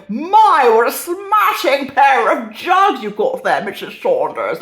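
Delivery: loud, high, drawn-out mock admiration; hammed-up leering comic character. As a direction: play a saucy comic innuendo, big and slow. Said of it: "he had to go".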